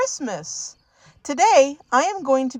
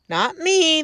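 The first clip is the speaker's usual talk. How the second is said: shouted denial; 6 semitones higher than usual. A narrator's reading. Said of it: "they all shouted".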